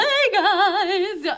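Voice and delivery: high, loud showy hello; playful USO greeter. Pretend. USO girl greeting the soldiers, playful high call. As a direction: call out to the troops in mock cheer. Acting Lee as a USO performer.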